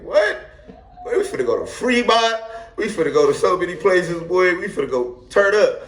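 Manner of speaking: high, giddy hype voice with laughs; bouncing with excitement at the plan; hyped excitement. Retold or imitated. Excited retold quote of friend.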